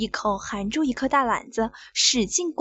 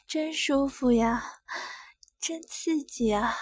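soft moans; 6 semitones higher, 6 dB quieter than usual. A narrator's reading. Moans of pleasure.

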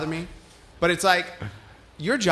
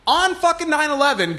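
high, loud indignant outburst; outraged burst; 8 semitones higher, 4 dB louder than usual. Real, not acted. Retelling the bank clerk's excuse, high and loud in outrage.

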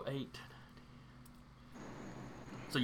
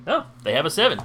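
sudden burst of excitement; a louder, excited realisation; 3 semitones higher, 7 dB louder, faster than usual. Real, not acted. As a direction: perk up suddenly, louder with a lively swoop in pitch. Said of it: "You sounded so excited".

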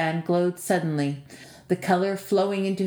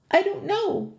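much higher, frightened plea; pleading; 13 semitones higher than usual. A narrator's reading. Andy begs the giant for his life, pleading. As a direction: beg the giant for mercy. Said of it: "Andy pleaded".

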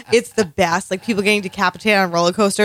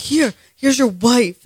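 imitating Logan; 5 semitones higher, much slower than usual. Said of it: "Logan's like".

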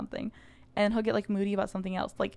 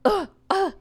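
high, loud mock grumble from the boyfriend; grumpy husband groan; 8 semitones higher, 7 dB louder, slower than usual. Wife imitating her husband's annoyed huff, mock grumble. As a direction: huff it out like an annoyed partner rolling over.

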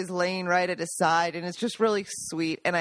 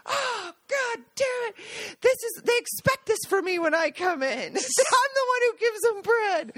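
high-pitched mock whining complaint; retold indignation; 12 semitones higher than usual. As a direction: draw it out like a wronged, put-out relative. Retold indignation over the bread.

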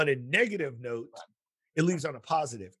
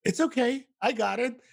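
higher protective teacher voice; Mama Bear teacher; 8 semitones higher, 3 dB louder than usual. Retold or imitated. Teacher rushing to protect her students, higher Mama Bear voice. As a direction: swoop in like a protective mother bear. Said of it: "like Mama Bear".

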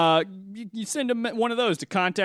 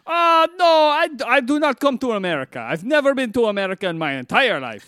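put-on higher-pitched foreign accent, broken English; foreign show host; 5 semitones higher than usual. Foreign guest who never came to America, higher accented voice. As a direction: talk like an eager visitor from abroad.

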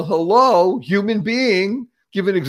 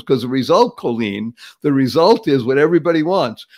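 normal talking voice

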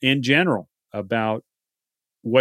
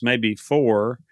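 ordinary talk

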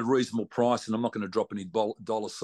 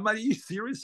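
half-asleep disbelief; 10 semitones higher than usual. Retold or imitated. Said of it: "I'm half asleep".

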